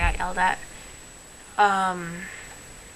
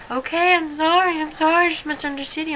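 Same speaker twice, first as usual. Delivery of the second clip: higher-pitched imitation of an apology; hurried-sounding "sorry" pitched up, imitating a friend; mock apologetic friend; 8 semitones higher than usual. Friend backing down after a misunderstanding, mock apologetic.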